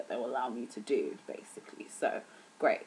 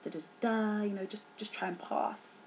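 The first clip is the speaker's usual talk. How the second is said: soft, higher-pitched mimicry of lecturers soothing students; higher, quieter put-on voice, placating; reassuring lecturers; 5 semitones higher, 5 dB quieter than usual. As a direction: imitate a lecturer lightly brushing off worries. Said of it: "our lecturers were like".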